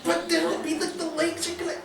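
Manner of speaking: very high, squeaky inner-voice protest; little protesting voice. Acted. Ignored inner voice objecting to the artist, high protest. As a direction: protest in a tiny high-pitched voice. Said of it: "that little negative shape voice".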